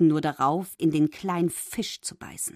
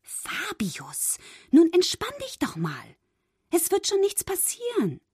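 lightly soothing mermaid voice; calm him down kindly; reassuring; 12 semitones higher than usual. A narrator's reading. Mermaid soothing her nervous friend, lightly reassuring. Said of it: "beruhigte ihn die Meerjungfrau".

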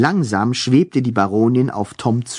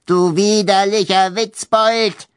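angry ghost baroness; 8 semitones higher than usual. Acted.

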